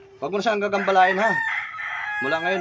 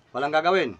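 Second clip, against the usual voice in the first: ordinary talk, nothing marked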